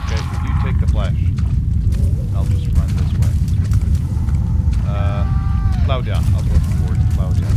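fast, loud panicky talk; half-laughing loud escape; loud hurried shouting. Real, not acted. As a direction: blurt it out while running away. Fleeing monster in game.

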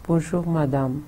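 Bonjour, madame, said flat and dull; flat forced greeting. Shown as a demonstration. Teacher shows a greeting said without feeling. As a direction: mumble it like you were made to. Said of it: "ये नहीं लग रहा कि आप किसी को greet कर रहे हैं".

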